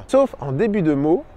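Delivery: usual speaking voice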